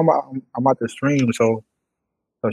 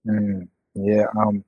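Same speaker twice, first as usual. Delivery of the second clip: ordinary delivery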